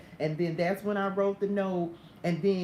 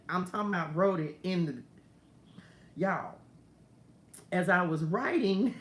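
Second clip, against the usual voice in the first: ordinary talk, nothing marked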